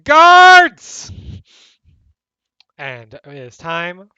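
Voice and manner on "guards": high, full-force shout; calling for guards. Acted. Architect summoning guards as the horn sounds, urgent shout. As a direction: bellow for help across the distance.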